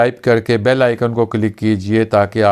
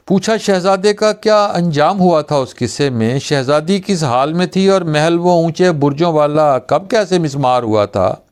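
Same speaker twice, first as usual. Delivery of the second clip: higher-pitched run of questions without pauses; breathless-run verse recital, questions tumbling together; all in one breath; 6 semitones higher than usual. Acted. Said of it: "एक ही सांस में सब कुछ पूछ रहे हो".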